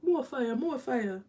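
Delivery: usual speaking voice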